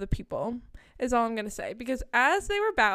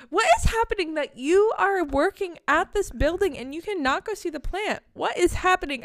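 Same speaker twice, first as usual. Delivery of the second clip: high, loud disbelief; incredulous; 6 semitones higher, 5 dB louder than usual. Retold or imitated. Fan asking the worker in high disbelief. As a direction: demand an answer in disbelief.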